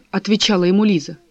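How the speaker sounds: ordinary delivery, nothing marked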